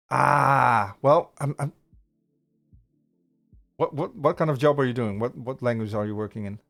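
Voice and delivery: ordinary talk, nothing marked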